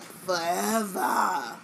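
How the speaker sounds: very drawn-out, theatrical single word; dramatic gif imitation. Host imitates the girl in the gif, dramatic. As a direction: stretch it right out like a dramatic reveal. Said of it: "with the dramatic turn".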